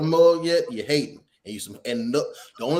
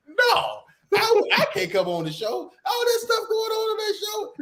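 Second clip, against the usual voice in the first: high, shocked refusal from mom; imitating mom. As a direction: mimic a scandalised mother refusing. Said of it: "she was like".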